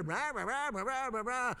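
imitated "rah rah" jabber; high-pitched nonsense chatter; mock babbling. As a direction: babble on like flustered Peter. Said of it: "You got Peter".

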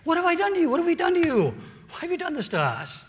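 high, loud indignant rebuke; rebuking. Retold or imitated. King scolding Abraham for the lie, high indignant rebuke. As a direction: cry out wounded and accusing. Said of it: "he rebukes Abraham".

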